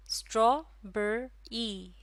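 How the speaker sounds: Strawberry in three slow beats, straw, बे, री; syllable by syllable. Shown as a demonstration. Teacher asking how many syllables, word slow and separated. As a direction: break strawberry apart with clear gaps. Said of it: "इसमें है तीन syllable".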